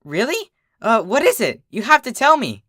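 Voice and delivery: high, bouncing eager pleading; a giddy filly begging for the secret; excited school filly. Acted. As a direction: squeal it out, desperate to know. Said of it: "dancing around me like an excited school filly".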